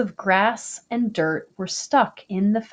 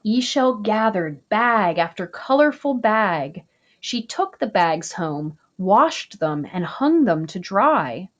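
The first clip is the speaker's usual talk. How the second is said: plain reading voice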